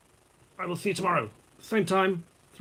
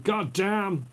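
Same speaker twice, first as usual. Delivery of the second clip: higher, drawn-up mock American exclamation; mock shouted American; 6 semitones higher than usual. Man quoting English soldiers always swearing, mock shout with American accent. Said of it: "they were always shouting".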